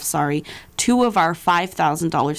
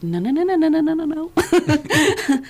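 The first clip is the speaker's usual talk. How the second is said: mock alarmed refusal, climbing higher; reining someone in; 11 semitones higher than usual. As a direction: imitate someone frantically putting on the brakes. Assistant reining her in, retold.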